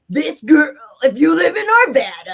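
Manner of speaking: loud, high wrestling announcer voice; funny voice. Retold or imitated. Recalling her speech, booming a WWE-style funny voice. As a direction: bellow it like a WWE hype man. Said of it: "I did a funny voice for him".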